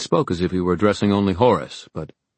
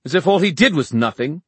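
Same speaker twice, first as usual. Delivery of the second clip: high, loud scornful outburst; raised, bitter retort; spat; 11 semitones higher, 7 dB louder than usual. A narrator's reading. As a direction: spit it out in indignation. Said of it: "Mark spat".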